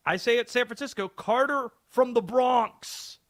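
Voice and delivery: a quick, hammed-up Bronx accent; cheesy mock Bronx accent announcing the caller; bad Bronx accent. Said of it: "Awful accent there".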